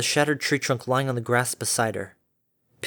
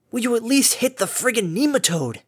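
exasperated; 9 semitones higher than usual. Acted. Harmony exasperated at Derpy.

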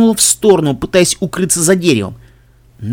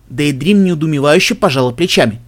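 ordinary delivery, nothing marked

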